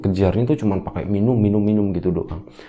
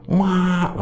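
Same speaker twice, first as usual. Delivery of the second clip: put-on child's cry; 11 semitones higher than usual. Small child calls mother at night, mock high cry. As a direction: imitate a frightened child calling for mother. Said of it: "anak kecilnya tuh teriak".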